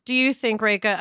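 ordinary delivery

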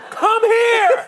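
very high street holler; big, high-pitched mock shout; yelled summons. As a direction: holler at a passerby to come over. Re-enacts his yelled street show.

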